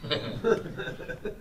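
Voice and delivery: airy high giggle; a quick unvoiced chuckle; breathy laugh. Real, not acted. Teacher laughing at own joke, airy giggle.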